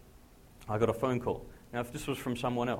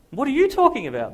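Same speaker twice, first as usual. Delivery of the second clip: high, loud disbelieving shout; baffled shout; 14 semitones higher, 14 dB louder than usual. Retold or imitated. Friend on the phone, stunned, shouting in disbelief.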